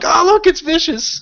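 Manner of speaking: high, slow amused squeal; mock delighted coo. Podcaster imagining himself amused by a chasing snake, mock delight. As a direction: gleefully gush at a little snake. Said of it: "I'd be like".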